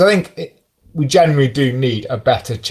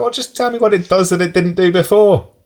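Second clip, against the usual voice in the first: higher, fed-up mock plea; exasperated retort; 7 semitones higher than usual. Reader fed up with jargon-filled release notes, in an exasperated higher plea. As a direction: cut through the jargon, exasperated. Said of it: "I was like".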